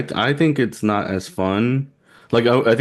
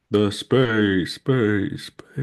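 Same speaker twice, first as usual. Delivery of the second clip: echo effect; much slower than usual. Pretend. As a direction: fake echo that dies away. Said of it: "kinda, like, uh, echoes out".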